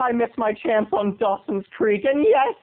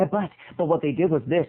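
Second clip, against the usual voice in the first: ordinary talk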